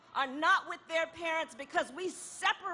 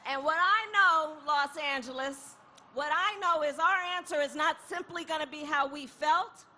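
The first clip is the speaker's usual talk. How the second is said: ordinary delivery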